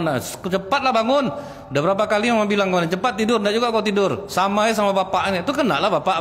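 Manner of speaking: high, hurried nagging mother. Retold or imitated. Mother nagging her child to wake for school. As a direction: hurry the sleepy child out of bed.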